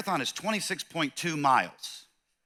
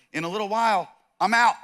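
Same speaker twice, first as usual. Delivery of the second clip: casual Jesus; 6 semitones higher, 6 dB louder than usual. Retold or imitated. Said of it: "Jesus looks at them and he says".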